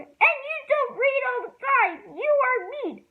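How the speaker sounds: high loud shout. A narrator's reading. Ramona yelling at Beezus, high and loud. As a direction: keep yelling at her. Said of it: "shouted Ramona".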